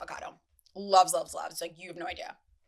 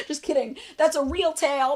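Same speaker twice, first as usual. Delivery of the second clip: ordinary talk, nothing marked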